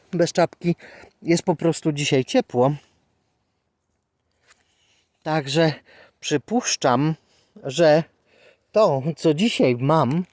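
thrilled mushroom picker gushing; excited. Real, not acted. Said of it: "trzeba jakoś te emocje odreagowywać".

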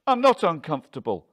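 loud, high, quick denial; heated, hurried protest; defensive outburst. A narrator's reading. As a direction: fire back a denial, fast and loud. Husband snaps a denial.

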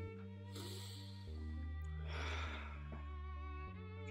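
long, slow, soft breath out; long exhale. Shown as a demonstration. Hypnotist showing a listener how to breathe, slow sigh. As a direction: let a relaxing breath flow all the way out.

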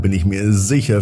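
plain reading voice

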